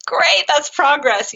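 high, drawn-out cheer; slow, high-pitched praise, lightly played; retold encouragement. Therapist cheering the dad's first attempt, retold encouragement.

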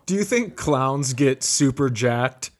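normal talking voice

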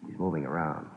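low hushed voice in the dark. Acted. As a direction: keep it down, someone's outside. Hiding from gunman in dark room.

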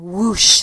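loud, drawn-out whoosh; long sweeping sound effect; whooshing noise. Retold or imitated. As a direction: imitate something sliding fast, loudly. Imitating car seat sliding.

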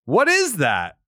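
ordinary talk

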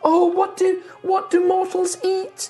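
high, flustered fussing voice; high, hesitant creature voice; tree woman. Acted. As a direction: dither in a high-pitched put-on voice.

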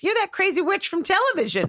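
high-pitched mock fan recognition; excited recognition. Athlete recognising a TV actress, high excited imitation. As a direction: pitch it up, imitating someone who spots a celebrity.

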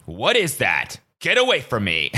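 slow, drawn-out dude-bro disbelief; lightly voiced bewildered bro; hammed-up freaked-out dude; mock bro voice. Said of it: "been like".

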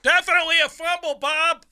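high, quick mock commentary; eager booth commentator. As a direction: mimic an excited announcer telling his partner. Mimicking the booth commentator's eager call.

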